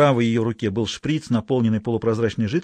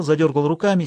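ordinary delivery

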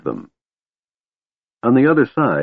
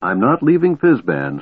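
plain reading voice

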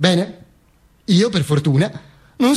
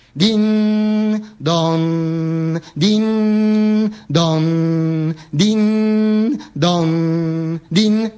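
imitated church bell; much slower than usual. Church clock striking the hour at the cemetery, sustained two-note chime. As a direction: toll like a church clock on two held notes. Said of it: "L'orologio della chiesa batteva le ore".

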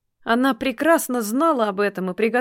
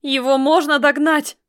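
higher, louder gasping outcry; breathless cry; 5 semitones higher, 5 dB louder than usual. A narrator's reading. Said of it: "задыхаясь, вскрикнула Эрхина".

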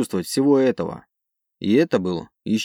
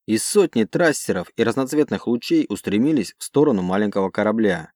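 ordinary delivery, nothing marked

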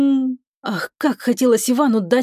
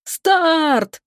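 launch cry; 8 semitones higher than usual. A narrator's reading. Four crew members release their tension, a mild high cry. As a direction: cry out the start signal, held back in volume. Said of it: "закричали сразу четыре голоса".

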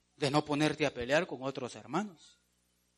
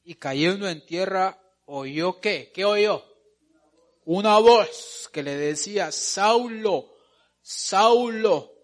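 loud, raised preacher's reading voice; dramatic scripture reading; 3 semitones higher, 7 dB louder, slower than usual. Acted. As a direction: proclaim the Bible story, drawing out key words. Reads scripture aloud to congregation. (lectura bíblica proclamada con fuerza)

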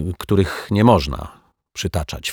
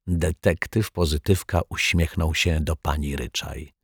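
plain reading voice

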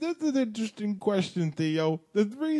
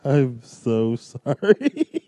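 louder, slow, drawn-out mock apology; 5 semitones lower, 6 dB louder, much slower than usual. Answering B K's sign-off, loud slow impression. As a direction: drag out the apology in a put-on voice. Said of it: "doing the impersonations of C J and B K".

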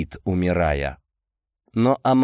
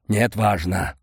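ordinary delivery, nothing marked

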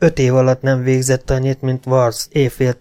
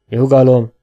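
usual speaking voice

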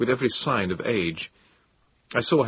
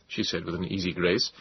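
ordinary delivery, nothing marked

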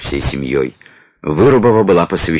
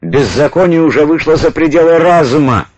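furious, higher raised shout; berserk rage; 9 semitones higher, 4 dB louder than usual. Acted. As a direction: yell it in a fury. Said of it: "в бешенстве заорал Климович". (яростный крик)

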